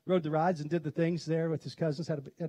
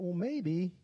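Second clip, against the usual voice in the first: doubtful thought. Retold or imitated. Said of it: "he thought".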